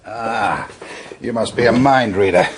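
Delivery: loud, laughing delight; laughing greeting. Acted. Hadley delighted to find a drink waiting, laughing loudly. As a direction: laugh it out as the beer arrives.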